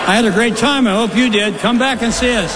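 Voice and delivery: the parrot cheerfully outs him. Acted. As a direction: parrot greeting the husband like a regular customer. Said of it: "The parrot says".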